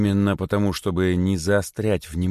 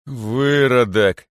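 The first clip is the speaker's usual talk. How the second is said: loud, slow, raised insult; contemptuous insult; 5 semitones higher, 6 dB louder, much slower than usual. A narrator's reading. Great python answers Korotyshka, refusing to argue, contemptuous. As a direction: drag the single word out loudly with contempt. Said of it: "отвечал великий питон".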